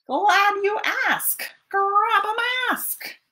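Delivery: lightly voiced raccoon; cheeky raccoon. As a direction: answer like a sly little raccoon.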